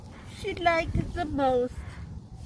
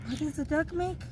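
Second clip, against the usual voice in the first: plain reading voice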